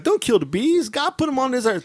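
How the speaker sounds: high, lightly mocking preachy voice; mock a pious scold; preachy stranger. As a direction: stranger pleading for the bees, high. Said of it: "the guy's like".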